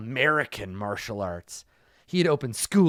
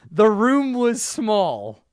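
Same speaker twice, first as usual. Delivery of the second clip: slow dramatic; 5 semitones higher, 5 dB louder, much slower than usual. Shown as a demonstration. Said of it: "Let me read this again".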